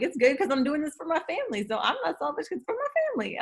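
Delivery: high, fast, self-justifying chatter; hammed-up rationalizing; mock defensive. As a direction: rattle off the excuse in a put-on voice. Mocking self-justifying excuses.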